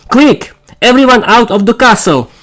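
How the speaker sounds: high urgent command to flee; commanding call. Acted. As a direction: order everyone out, urgent. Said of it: "commanded Zofia".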